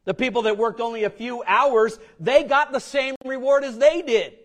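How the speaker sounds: mock grumbling. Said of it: "You say".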